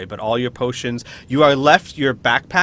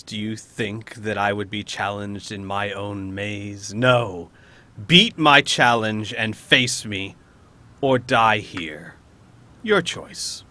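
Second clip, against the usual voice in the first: slow, scornful villain ultimatum; menacing monster with weighty pauses; imperious beholder; 4 semitones lower, slower than usual. Acted. Beholder refuses and gives an ultimatum.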